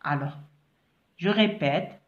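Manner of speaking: usual speaking voice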